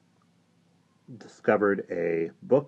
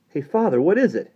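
high, loud, excited question; urgent, pitched-up curiosity; eager boy asking; 10 semitones higher, 8 dB louder than usual. A narrator's reading. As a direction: call out eagerly in a boyish pitch. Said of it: "asked Fergie as he scrambled".

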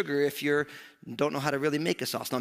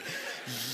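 quick real laugh in the middle of a story; a brief chuckle breaks through; short laugh; 4 semitones lower, 9 dB quieter than usual. Real, not acted. Grandson laughing while recalling grandma's teasing.

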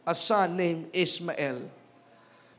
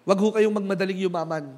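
ordinary talk, nothing marked

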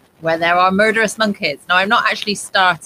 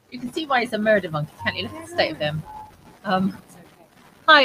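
normal talking voice

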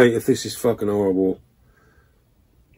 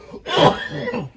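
cough laugh; 4 dB louder than usual. Real, not acted. Coughing from spicy bean.

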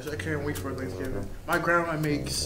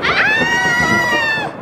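loud high shriek of fright; terrified scream; 15 dB louder than usual. Acted. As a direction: scream as the monster appears. Murder turkey appears.

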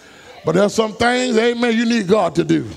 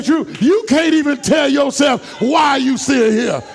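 preaching at full force; 3 semitones higher than usual. Acted. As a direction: preach it out, building the fire. Preacher shouting to congregation.

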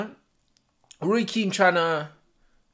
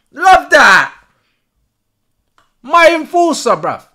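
much louder, higher delighted shout; excited praise; 8 semitones higher, 12 dB louder, slower than usual. Real, not acted. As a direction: hype up his midfielder. Cheering tackle.

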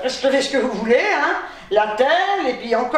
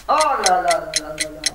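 exasperated groan; 9 semitones lower than usual. Real, not acted. Tired patient at a loss for a word, exasperated. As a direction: sigh it out, weary of yourself.

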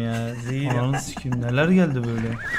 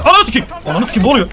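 sudden shout; 5 semitones higher, 4 dB louder, much faster than usual. Real, not acted. Sudden outburst during game play.

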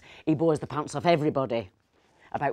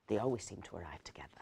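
hushed gossipy whisper, breath only; a sly little whispered aside; 7 semitones lower, 10 dB quieter, faster than usual. Acted. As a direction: lean in and whisper it like a secret. Gossipy aside about the couple.

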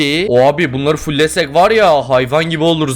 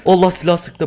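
normal talking voice

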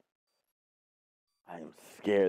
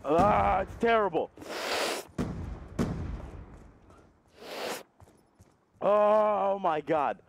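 higher, drawn-out pained groan; mouth burning from spicy food; 5 semitones higher, much slower than usual. Real, not acted. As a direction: groan slowly through the spice burn. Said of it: "my throat is burning".

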